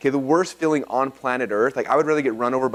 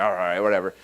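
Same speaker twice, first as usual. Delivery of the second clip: lower, grudging mutter; grumpy; 5 semitones lower than usual. Retold or imitated. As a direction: grumble it reluctantly. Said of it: "kind of grumpy".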